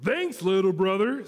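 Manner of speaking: lightly voiced big truck; grateful dump truck. A narrator's reading. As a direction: thank a little friend heartily. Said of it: "said Dump to Blue".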